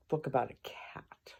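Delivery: whispered afterthought. Real, not acted. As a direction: drop to a whisper on it. Trailing aside about cats.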